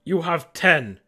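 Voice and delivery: commanding native warning; stern ultimatum. Acted. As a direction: lay down a deadline forcefully.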